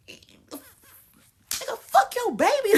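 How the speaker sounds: high, laughing shout; laughing yell. Real, not acted. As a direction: burst out laughing and yelling. Laughing outburst.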